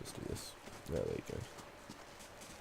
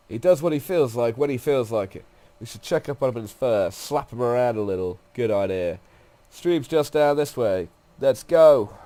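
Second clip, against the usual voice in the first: louder in-character read, building at the end; lightly voiced brisk guide; 4 semitones higher, 10 dB louder than usual. Guide proposing to check on a companion, lightly voiced and assertive. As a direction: lightly play a no-nonsense guide, finishing loud. Reads game guide's dialogue aloud.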